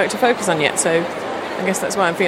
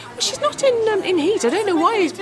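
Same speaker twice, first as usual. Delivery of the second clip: much higher, prim protesting owner; lightly mocking high owner voice; dog owner protesting; 12 semitones higher than usual. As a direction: voice the owner's indignant denial. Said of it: "the owner was saying".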